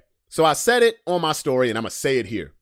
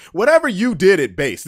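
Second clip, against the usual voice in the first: emphatic; 6 semitones higher, 8 dB louder than usual. Real, not acted. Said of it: "this is what I wanna say".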